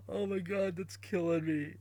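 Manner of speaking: usual speaking voice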